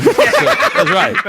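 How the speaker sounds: said through a high laugh. Real, not acted. Amused by the host's prison joke, laughing. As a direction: laugh the words out.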